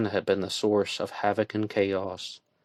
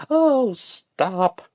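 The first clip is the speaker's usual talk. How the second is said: cutting in; 12 semitones higher, 6 dB louder than usual. A narrator's reading. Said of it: "Joanna cut him off".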